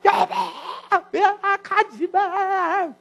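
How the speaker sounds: very slow, high, drawn-out wail; mock-tragic graveside wailing; wailing plea. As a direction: stretch it into a sobbing plea to the dead wife. Husband collapses at wife's grave.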